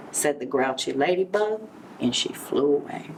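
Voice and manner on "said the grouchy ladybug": plain reading voice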